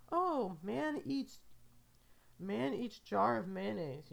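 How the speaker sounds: hammed-up headline about the mayonnaise man; mock news headline. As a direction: read it like a breaking news anchor. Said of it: "I imagine that's what the news story is".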